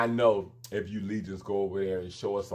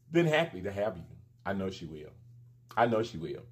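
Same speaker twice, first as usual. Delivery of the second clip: normal talking voice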